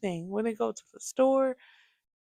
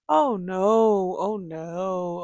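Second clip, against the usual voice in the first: normal talking voice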